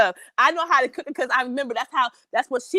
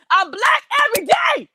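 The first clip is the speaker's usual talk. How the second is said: impassioned shout; 11 semitones higher, 4 dB louder than usual. Retold or imitated. Retelling argument, climax of repetition.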